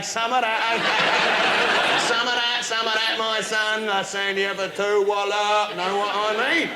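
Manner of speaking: loud cocky lad boasting; laddish bragging. Acted. Boasting at the shop counter, loud and laddish. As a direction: brag like a lad on the pull.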